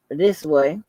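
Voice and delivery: ordinary talk, nothing marked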